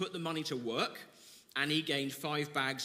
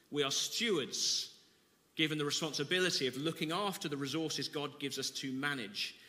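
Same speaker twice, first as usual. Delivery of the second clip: ordinary talk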